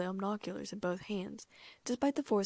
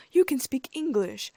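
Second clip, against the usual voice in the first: high, loud, slow indignation; angry; 6 semitones higher, 9 dB louder, slower than usual. A narrator's reading. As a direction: accuse them, stretching the words. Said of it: "Fudge said angrily".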